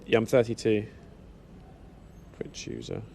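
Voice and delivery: normal talking voice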